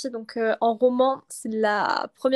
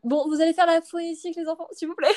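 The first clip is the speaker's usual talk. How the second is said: high-pitched mock schoolteacher voice; teacher addressing pupils; 6 semitones higher than usual. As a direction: call the class to phonetics like a teacher. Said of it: "il y avait pas ce truc de".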